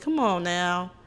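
usual speaking voice

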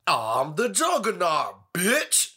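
slow, high hammed-up movie line; Juggernaut quote. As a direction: belt it like the Juggernaut. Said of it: "That shit's hilarious".

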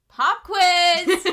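sudden high, loud playful shout; quiz announcement. Real, not acted. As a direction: burst out like a game show host.

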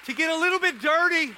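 full-force preaching. Acted. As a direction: lift the room, preach it out full voice.

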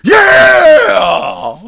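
hammed-up party yell; rowdy cheer after the crash; mock cheer.

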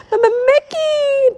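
high, stammering, drawn-out call; slow high wail for help; terrified cry. A narrator's reading. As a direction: stammer the name in panic. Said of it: "Donald cried".

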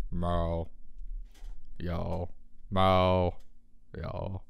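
low, gruff meow; deep cat meow. Retold or imitated. Imitating the deep-voiced cat, a low meow. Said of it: "sounds like a sports announcer".

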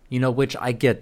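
normal talking voice

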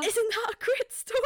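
high, loud mock excitement; excited date voice. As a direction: imitate an eager storyteller fishing for approval. Voicing her pleased date in story.

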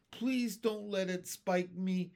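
frightened, imploring little plea; begging. A narrator's reading. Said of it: "begged the Earthworm".

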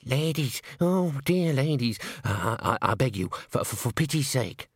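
stammering, anxious begging; slow, halting plea from a cornered man; pleading. A narrator's reading. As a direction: falter and beg for mercy. Henry begs the women for mercy.

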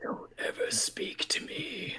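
slow, hushed menacing whisper; overacting menace. Mimicking the warrior warning off the desk guy, slow menacing whisper. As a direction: whisper it like a hammy immortal villain. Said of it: "overacting menace of".

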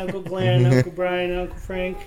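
gentle bedtime voice for a little one. Real, not acted. Voicing goodnights with toddler.

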